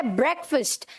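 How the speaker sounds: ब्रेकफास्ट as "BREK-fust"; short e and reduced second vowel in ब्रेकफास्ट; correct pronunciation. Shown as a demonstration. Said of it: "क्या बोलेंगे?".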